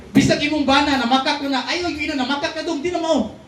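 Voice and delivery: high, fast scolding wife voice; hammed-up wife correcting her husband; mock nagging wife. (nagbiaybiay nga asawa nga nagbadlong)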